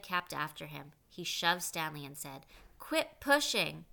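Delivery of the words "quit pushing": high, loud, slow mocking jeer; loud slow taunt. A narrator's reading. Zigzag provoking Stanley while shoving, loud slow jeer. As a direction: stretch it out to provoke him.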